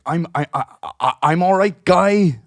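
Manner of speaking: high, loud, stammering mock retort. Offended woman throws the words back, high, loud mockery.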